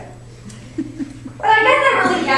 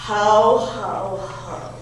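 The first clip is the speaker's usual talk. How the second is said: low, mocking ho-ho-ho fading away; Santa Claus; 8 semitones lower, much slower than usual. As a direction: imitate an annoying laugh, deep and trailing off. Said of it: "laughing at me with that annoying laugh".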